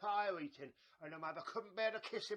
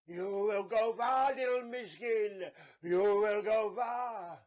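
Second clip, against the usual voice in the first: put-on slow, loud, portentous old granny; slow loud solemn prophecy; prophetic granny; 2 semitones higher, 9 dB louder, slower than usual. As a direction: intone it like a fortune. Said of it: "and say".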